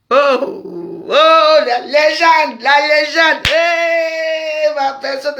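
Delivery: loud, high calls of joy; excited shout. Real, not acted. Shouting greetings at a friend she calls a legend. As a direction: hail her like a star. Calling out to a visitor.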